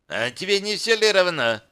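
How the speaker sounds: high-pitched snide retort. A narrator's reading. Girl brushes off the captive's question, snide. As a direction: toss it back in a high, needling voice. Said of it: "съязвила девка".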